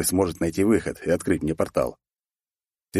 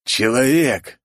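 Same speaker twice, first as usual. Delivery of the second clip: raised, understated angry exclamation; a touch of a growl, pitched up; growled through teeth; 5 semitones higher, 4 dB louder, much slower than usual. A narrator's reading. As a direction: spit out the word with some force. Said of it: "сквозь зубы прорычал".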